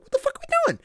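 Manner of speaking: sudden high-pitched outburst; a cartoonish shriek of confusion over the butter; mock exasperation. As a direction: squeak it in disbelief. Exasperated at game's butter prompt.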